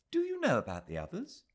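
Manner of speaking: a quick, curious interruption; Miss Quested. A narrator's reading. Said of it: "said Miss Quested, unintentionally breaking a spell".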